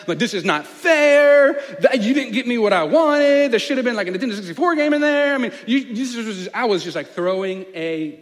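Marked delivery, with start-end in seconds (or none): 0.2-5.4 put-on high, loud ten-year-old tantrum, no real tears; loud mock-crying childish complaint; tantrum whining. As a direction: wail it out like a spoiled kid denied his present. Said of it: "I'm just, like, crying".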